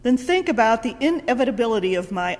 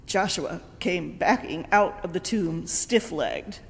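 ordinary talk

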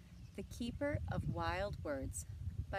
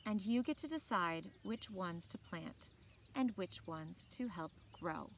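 put-on reading a picture book aloud to children; bright, clear read-aloud voice; story narration; 3 semitones higher than usual. A narrator's reading. Teacher reading a book to the class, in a storytelling voice.

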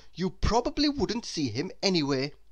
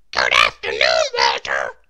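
tiny character voice, louder and airy; Mister Small's voice; 10 dB louder than usual. Acted. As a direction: voice the little man with a strained, airy tone. Mister Small greets the worm.

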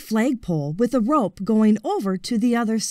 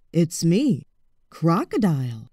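low, sly crocodile calling from the water; a lurking crocodile luring him in, low; low-voiced crocodile; 5 semitones lower than usual.

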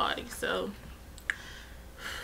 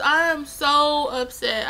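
normal talking voice

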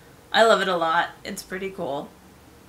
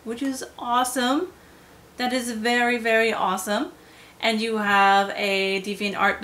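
ordinary talk

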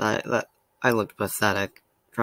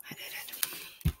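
hushed, slow, voiceless; whispered; 12 dB quieter, slower than usual. Real, not acted. Fan marking the end of the series, whispered. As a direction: whisper it slowly to yourself.

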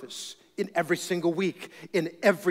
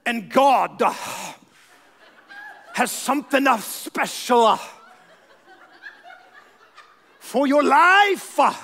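mock evangelist; 6 semitones higher, 4 dB louder than usual. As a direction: stretch every word like a showy evangelist. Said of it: "everything he said was an extra syllable".

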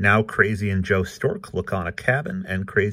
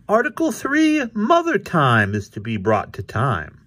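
very high, loud proclaiming cat; Krazy Kat; 10 semitones higher, 4 dB louder than usual. A narrator's reading. Krazy proposes articles to Ignatz, high proclaiming voice. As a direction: announce it grandly way up high.

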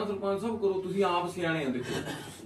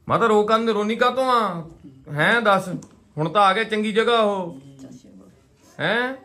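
loud coaxing; 4 semitones higher, 6 dB louder than usual. Real, not acted. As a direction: speak up loudly so she hears and stops crying. Coaxing a crying old mother.

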